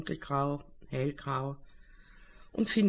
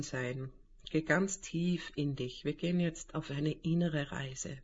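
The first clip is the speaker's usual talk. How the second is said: calm guided meditation voice; right in your ear; 3 dB quieter than usual. Acted. Leitet eine Meditation an. (ruhige Meditationsstimme)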